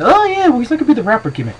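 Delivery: very high, loud whiny mock voice; mocking fans. Mocking fans who miss Cena's rapper gimmick, squeaky. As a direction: sneer at the fans in a silly squeak. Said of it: "some people saying".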